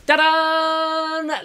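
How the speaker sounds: high, sung-out ta-da fanfare. Pretend. Host announcing a viewer's new video with a high fanfare ta-da. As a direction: trumpet it like a fanfare.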